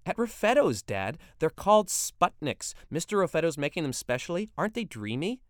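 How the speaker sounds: put-on a teen girl gushing to her dad about the new ice cream; giggly teen daughter. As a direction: bubble over like a giddy schoolgirl. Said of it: "Betty giggled".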